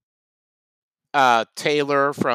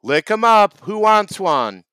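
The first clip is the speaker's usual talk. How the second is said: vendor's call; 7 semitones higher, 5 dB louder than usual. Acted. Vendor calling out his wares.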